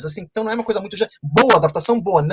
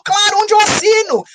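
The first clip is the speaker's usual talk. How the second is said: much higher and louder, eager; eager acceptance; 6 dB louder than usual. Acted. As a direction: jump at the deal with an excited high voice. Poor man eagerly accepts deal.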